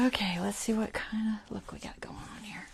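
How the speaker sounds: hushed inspection whisper. Real, not acted. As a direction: whisper to yourself while checking. Inspecting the work quietly.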